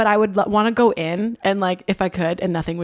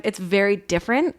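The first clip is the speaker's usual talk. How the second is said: ordinary talk, nothing marked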